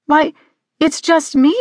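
put-on high, innocent girl's reply; composed girl. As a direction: answer up high, politely explaining yourself. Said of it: "regaining her composure".

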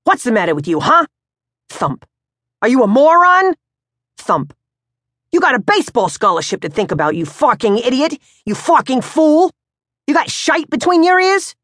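high, loud, rapid abuse building to shrill yells; raging father. Acted. Pops thumping his son's chest, enraged. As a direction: batter him with insults.

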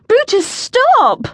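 high, drawn-out yell; urgent high-pitched cry, stretched out; shouted command. A narrator's reading. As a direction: yell at a runaway dog to stop. Shouting at runaway dog.

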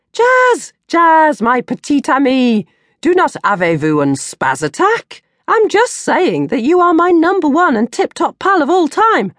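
butter her up with over-the-top sweetness; high gushing wheedle to her best friend; wheedling. Acted.

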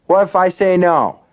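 squaring up to the bartender; demanding. A narrator's reading. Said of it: "Hugh demanded".

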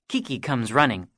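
usual speaking voice

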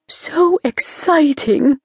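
a thrilled witch gushing about the ball; beaming. Acted. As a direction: gush with pleasure. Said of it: "beamed with pleasure".

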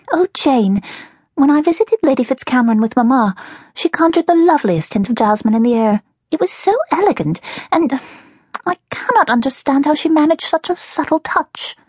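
put-on high-pitched, admiring girl character; gushing younger sister. Narrator voices younger sister Melody.

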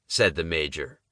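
ordinary delivery

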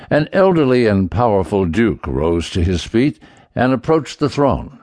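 ordinary delivery

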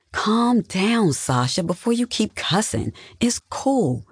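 high, light calming voice; high-pitched, dismissive reassurance; placating. A narrator's reading. Sister soothes outraged younger sister.

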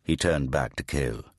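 usual speaking voice

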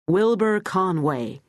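slow, stretched American drawl on Wilbur Conway. Acted. Wilbur introduces himself to Betty, slow American drawl. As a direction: lean into a lazy American drawl, drawing out Wilbur. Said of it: "He said it in an American drawl".

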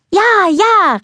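high, loud eager yes; gleeful and bursting with joy; excited call. Acted. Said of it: "rief Anna". (hoch und laut vor Freude rufend)

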